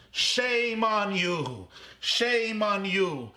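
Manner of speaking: high, very slow, drawn-out reproach; anguished cry. Retold or imitated. Old man scolding the banker over his home, anguished slow cry. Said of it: "the ninety-one-year-old man cried".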